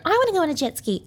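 put-on high little-kid whine; childlike voice. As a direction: say it like an eager child. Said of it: "my brain literally was like a child".

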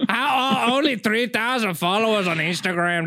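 a hint of Mexican accent. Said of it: "Did you say Mexican voice?".

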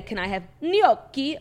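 GNOCCHI drawn out slowly in showy Italian; overdone Italian accent. Shown as a demonstration. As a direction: put on too much Italian for "gnocchi". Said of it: "I would never say".

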